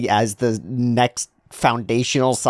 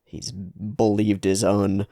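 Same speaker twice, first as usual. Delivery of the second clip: normal talking voice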